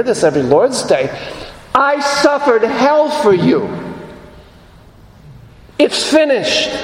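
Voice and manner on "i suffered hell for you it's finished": voicing Jesus. Preacher voices Jesus' words.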